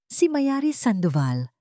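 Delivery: ordinary delivery, nothing marked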